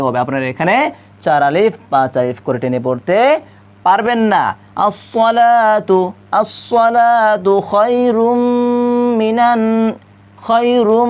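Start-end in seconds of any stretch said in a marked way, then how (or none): 4.9-11.1 slow আস-সালাতু short, খায়রুম and মিনান stretched; vowel lengths. Shown as a demonstration. Teacher marks which vowels may be drawn out. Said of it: "এখানে আপনারা টানতে পারবেন".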